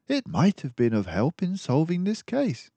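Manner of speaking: a friendly doctor mouse musing aloud; Dawson the narrator mouse. Acted. Dawson continues.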